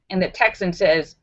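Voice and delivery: usual speaking voice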